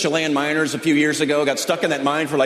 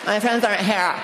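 higher, whiny hammed-up teen; mock distraught daughter; 4 semitones higher, 4 dB quieter than usual. Mimicking the daughter stranded at the mall, hammed-up whine. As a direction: overplay the teen's despair. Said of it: "That's not how she talks".

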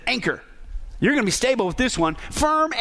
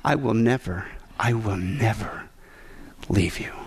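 NEVER set down firmly in a low, slow voice; low and deliberate, weight on NEVER, pauses before "leave you"; emphatic promise; 11 semitones lower, 4 dB quieter than usual. Acted. Preacher voicing God's promise to believers, slow emphatic NEVER. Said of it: "Talk about being emphatic from God".

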